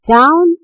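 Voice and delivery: "down" high with a rising tune; high, rising, isolated "down"; stressed word. Shown as a demonstration. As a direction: lift "down" as a strong beat.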